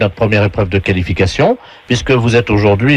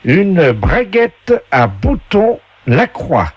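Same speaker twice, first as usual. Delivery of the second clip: slow, higher game voice; game show announcer; 7 semitones higher, much slower than usual. Acted. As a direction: announce each prize with drawn-out flourish. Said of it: "la voix du jeu".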